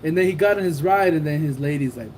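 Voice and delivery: ordinary delivery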